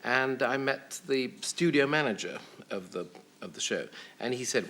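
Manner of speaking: ordinary talk, nothing marked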